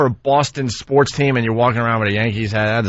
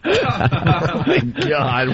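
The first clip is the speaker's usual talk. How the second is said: laughing; 8 semitones higher, much slower than usual. Real, not acted. Cracking up at the joke.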